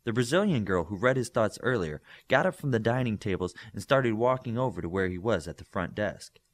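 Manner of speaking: ordinary delivery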